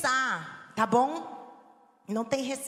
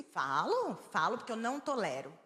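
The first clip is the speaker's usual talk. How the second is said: normal talking voice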